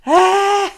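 high loud groan; frustrated wail. Acted. Rat looks at his ruined kitchen, frustrated wail. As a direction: wail at the mess. Upset over the mess.

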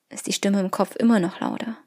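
ordinary talk

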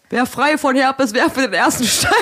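high, slow, laughing mock preacher; mock sermon. Mock Bible quote, laughing.

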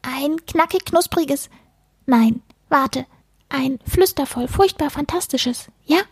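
high little creature voice, eager; squeaky, hungry book-munching critter; furry book-eater. A narrator's reading. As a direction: savour each word as a greedy furball.

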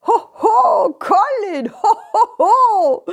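jolly high ho ho; hearty Santa greeting; Santa laugh. Acted. Santa arrives.

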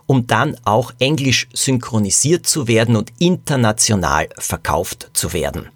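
usual speaking voice

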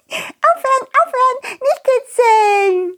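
high giggling squeal while tickled; being tickled. Acted. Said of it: "plötzlich kicherte jemand". (gekitzelt, hoch kichernd)

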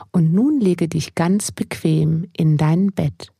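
slow soothing meditation voice; guided sleep meditation. Acted. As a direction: ease the listener gently into bed. (ruhige Einschlafmeditation)

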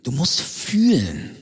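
slow, dreamy spiritual instructor; mystic guru voice. Acted. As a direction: sound like a self-styled mystic. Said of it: "jetzt dreht er durch".

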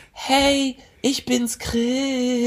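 very high, sweet falsetto; mock angel falsetto; angelic voice. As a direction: pretend your new voice is an angel's. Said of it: "wunderschöne Engelstimme".

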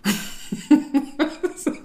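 high breathy burst of laughter. Real, not acted. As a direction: caught off guard, laughing. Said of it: "Entschuldigung, dass ich lachen muss".

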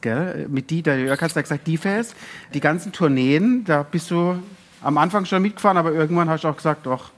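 usual speaking voice

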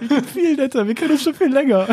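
high, whiny voice with a laugh; mock whiny self. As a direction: play the jealous self, overdone. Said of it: "So nach dem Motto". (hohe, jammernde Stimme mit Lachen, übertrieben eifersüchtig)

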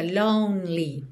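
"lonely" straight from N to L; silent middle E. Shown as a demonstration. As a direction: say "lonely" as "lone-lee", no middle vowel. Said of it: "Esse E no meio da palavra lonely não é pronunciado".

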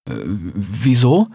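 slow, unsure question; hesitant. A narrator's reading. Rix asking the fox warily, hesitant and slow. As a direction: hang back, hesitating. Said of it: "fragte Rix zögernd".

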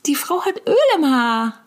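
put-on loud child. Child telling its mother loudly and in a high voice. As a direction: shout it like a tattling kid. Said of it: "in siebzehntausend Dezibel".

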